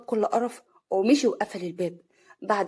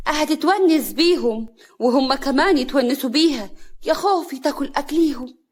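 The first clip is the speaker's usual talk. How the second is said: sarcastic; 4 semitones higher, 6 dB louder than usual. A narrator's reading. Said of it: "سعاد بسخرية". (بسخرية وتهكم)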